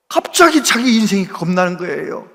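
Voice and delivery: ordinary talk, nothing marked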